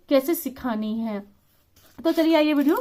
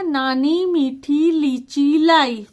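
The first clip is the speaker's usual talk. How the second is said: words laid out very slowly, one by one; slow dictation; much slower than usual. Shown as a demonstration. Teacher reading worksheet sentences aloud, slowly. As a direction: read each sentence as if a child copies it. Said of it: "इसमें क्या आएगा".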